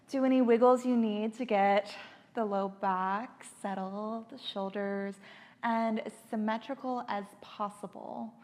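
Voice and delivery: calm Yoga Nidra leading, phrase by phrase; guided meditation. Acted. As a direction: guide them gently into deep relaxation. Leads guided relaxation practice.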